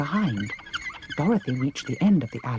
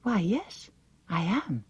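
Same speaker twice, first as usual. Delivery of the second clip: surprised; 5 semitones higher, slower than usual. A narrator's reading. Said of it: "said Dorothy in surprise".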